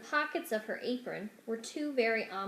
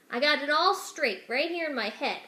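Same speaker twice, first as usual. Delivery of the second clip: plain reading voice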